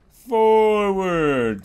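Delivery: high, stretched word sliding slowly down; sing-song swinging call. Real, not acted. Player swinging back and forward, sing-song call. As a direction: draw it out like riding a swing.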